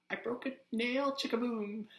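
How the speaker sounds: put-on high, loud valley girl voice. Shown as a demonstration. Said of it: "sound like a valley girl".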